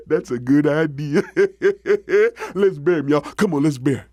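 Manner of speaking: loud chuckles breaking up the words; hammed-up frog laughter; gleeful laughing frog. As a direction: laugh with glee at the scheme. Big frog delights in the plan.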